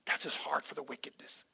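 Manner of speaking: soft unvoiced aside; hushed sincere whisper. Real, not acted. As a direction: breathe it tenderly. Tender aside after quote.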